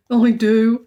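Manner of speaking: put-on loud eager boy's answer; boyish exclaim. A narrator's reading. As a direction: jump in eagerly. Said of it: "exclaimed Jonathan".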